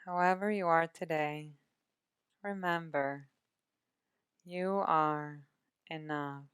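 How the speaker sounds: calm, measured meditation voice with long pauses; guided meditation. Acted. Meditation guide settles listeners into body and breath, calm guided meditation. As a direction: ease the listener into rest, unhurried, drawing out each breath cue.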